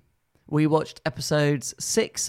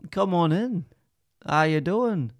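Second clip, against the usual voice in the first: put-on Northern shopkeeper; Northern accent; 3 semitones higher than usual. Shown as a demonstration. Shop owner calling customers in, put-on Northern accent. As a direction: demonstrate a bit of a Northern accent. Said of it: "This is a bit Northern".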